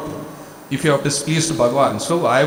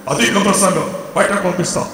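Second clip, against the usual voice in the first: higher-pitched stern scolding, retold; sharp dismissal, acted out in retelling; scary rebuke; 4 semitones higher, much faster than usual. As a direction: imitate a guru's scary telling-off. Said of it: "Swami's response was so scary".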